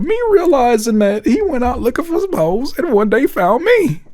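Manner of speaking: a hint of the sad wife, teasing; mock wife voice. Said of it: "She said".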